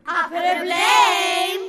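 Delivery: slow, drawn-out shouted "Problem"; incredulous shout. Acted. Host shouts back at the guest who fears a problem, slow and incredulous.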